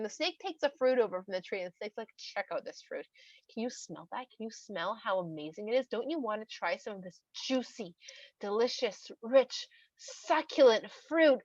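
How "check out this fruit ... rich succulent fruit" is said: seductive tempting. Acted. Snake offers the woman the fruit, seductively.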